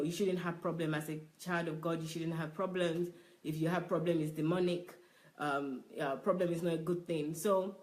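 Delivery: normal talking voice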